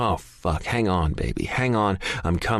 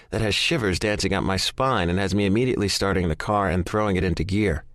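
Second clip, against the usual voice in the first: usual speaking voice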